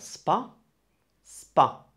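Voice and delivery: "spa" cut off as one syllable; isolated syllable. Shown as a demonstration. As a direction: sound out "spa" on its own. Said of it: "You have three syllables there".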